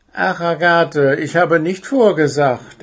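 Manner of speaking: little owl apologizing to his teacher. A narrator's reading. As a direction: plead your innocence. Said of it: "entschuldigte sich Willi".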